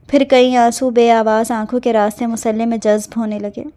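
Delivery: usual speaking voice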